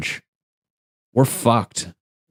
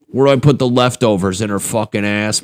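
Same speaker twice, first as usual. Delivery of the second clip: normal talking voice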